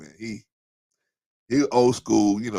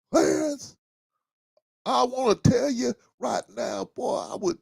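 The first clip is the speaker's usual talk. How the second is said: much higher, mock-mad Southern father; dad's angry Southern accent; 11 semitones higher than usual. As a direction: threaten the son like an old Southern dad. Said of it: "that's really when that accent came out".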